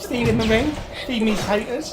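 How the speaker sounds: high, hammed-up creature demand; Smeagol. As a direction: whine for food as a little creature. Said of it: "Is that what Smeagol sounds like?".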